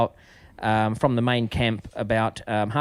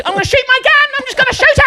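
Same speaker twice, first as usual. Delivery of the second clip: high, loud frustrated threat; mock angry; 8 dB louder, much faster than usual. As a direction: blurt it like a panicked kid losing his temper. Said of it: "really getting angry".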